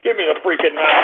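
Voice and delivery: low, menacing order; seething demand. Real, not acted. Host demanding something from his crew, seething demand. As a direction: keep the rage pressed down.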